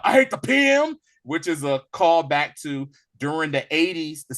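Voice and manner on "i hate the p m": loud, high-pitched quoted outburst; real loud. Retold or imitated. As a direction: belt it out much higher and louder. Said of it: "he says real loud".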